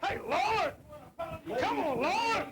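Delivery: high, urgent yells to break it up; alarmed shouting. Real, not acted. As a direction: shout in alarm at a sudden fight. Alarmed host as a brawl breaks out.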